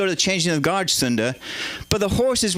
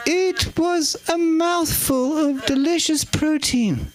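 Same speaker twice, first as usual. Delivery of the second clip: high, slow regal relish; high-pitched, drawn-out monarch impression; the Queen; 8 semitones higher, slower than usual. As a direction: savour each word in a high royal voice. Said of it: "Okay, Queen, get on with it".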